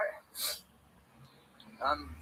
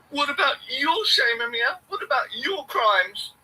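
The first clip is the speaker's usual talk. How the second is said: challenging others' voice, higher and slower; slow pitched-up taunt; mock accuser, higher; 7 semitones higher, 5 dB louder, slower than usual. Hecklers challenging Amir, mocking higher voice. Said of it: "others confronting Amir".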